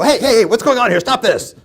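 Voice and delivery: loud, high barking to stop a fight; angry intervening shout. Shown as a demonstration. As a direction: storm in and yell at them to stop. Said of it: "walk into your dispute and go".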